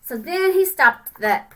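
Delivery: plain reading voice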